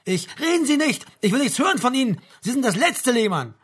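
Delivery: furious, high-pitched shouting; enraged officer's tirade; bellowing. A narrator's reading. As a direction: keep yelling, cutting the other man off. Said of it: "hochrot von der Brüllerei".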